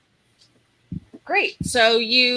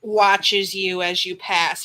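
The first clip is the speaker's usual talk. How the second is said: ordinary talk, nothing marked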